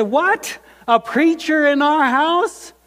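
high, loud, disbelieving cry; outraged disbelief at a preacher at home; shocked outrage. Retold or imitated. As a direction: recoil at the news in alarm. Shocked son hears preacher coming.

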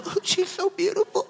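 high, soft weepy gushing; hammed-up happy tears; mock tearful. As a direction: gush softly as if crying with joy. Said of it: "I'm gonna be crying and I'm gonna be like".

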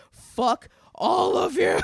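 high, slow furious shout; retold angry yell. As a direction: yell it at all the trucks. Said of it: "I was so fucking mad".

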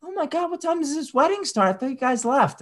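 squeaky saleswoman impression; mock saleswoman. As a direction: stretch it out like a fake-surprised clerk. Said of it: "She's like".

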